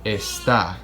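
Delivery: "Está" with the stress on the final TÁ; accentuated vowel. Shown as a demonstration. Spanish teacher showing the accent mark, "Está" stressed at the end. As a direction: lean on the last vowel of "Está". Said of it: "That is to accentuate that vowel".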